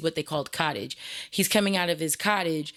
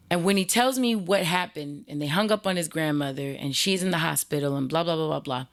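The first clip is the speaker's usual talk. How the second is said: ordinary delivery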